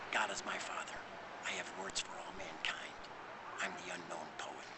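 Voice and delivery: low, soft whisper. Real, not acted. Poet's closing words.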